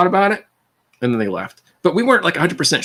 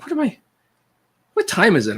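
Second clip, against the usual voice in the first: surprised; 8 semitones higher than usual. Retold or imitated. Bewildered at the morning fight.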